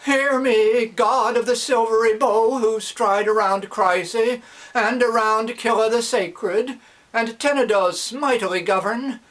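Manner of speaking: high, loud fervent prayer. Acted. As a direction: cry out to the god. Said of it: "Loudly the old man".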